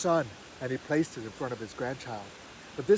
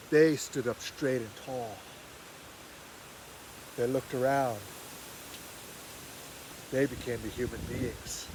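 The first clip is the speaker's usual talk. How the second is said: gentle ending; 4 semitones lower, 6 dB quieter than usual. A narrator's reading. Calm ending of myth.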